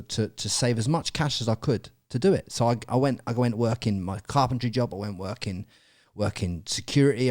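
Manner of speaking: ordinary talk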